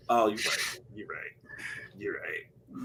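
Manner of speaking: mock sitcom family member giving in, fading away; mock meek giving in. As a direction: concede meekly, fading out. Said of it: "we can just like".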